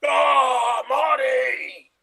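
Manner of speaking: frantic cry for Marty; Doc Brown shout. Acted. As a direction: call out like a wild-eyed scientist. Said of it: "you were Doc Brown".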